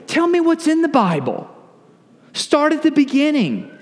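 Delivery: high-pitched, eager plea to be told; curious and keen, voice lifted high; eager questioner. Acted. Eager newcomer asking questions.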